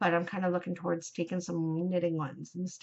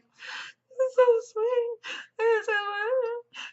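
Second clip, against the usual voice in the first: very high, slow tearful squeal; mock bawling; 14 semitones higher, slower than usual. Vlogger acts out her reaction to a touching story, mock bawling. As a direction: wail it slowly, imitating yourself crying. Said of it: "I was bawling".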